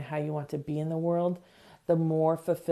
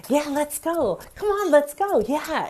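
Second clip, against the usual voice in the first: high, loud, hyped-up cheer; exuberant yes; 10 semitones higher, 4 dB louder than usual. Retold or imitated. Enthusiastic experience, high excited cheering yes. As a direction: pump everyone up, let's go. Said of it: "exuberant, enthusiastic experience".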